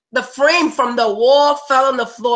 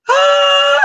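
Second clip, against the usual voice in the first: relieved cry; 6 dB louder than usual. Retold or imitated. Relief at paying the rent.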